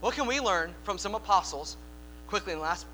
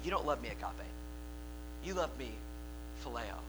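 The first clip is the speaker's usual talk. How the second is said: quiet gentle rebuke; 8 semitones lower, 10 dB quieter than usual. Acted. Jesus tells Peter gently.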